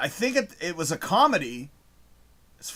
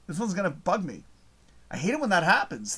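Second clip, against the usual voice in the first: ordinary talk, nothing marked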